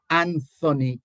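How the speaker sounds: "Anthony" with th as in thin; sounded TH. Shown as a demonstration. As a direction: say "Anthony" as AN-thuh-nee. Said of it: "how he pronounces his name".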